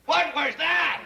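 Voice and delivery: high, alarmed shout; startled yell. Acted. Bank president startled by something in the office, yelling. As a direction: yell it out in high-pitched shock. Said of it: "Please don't yell so loud".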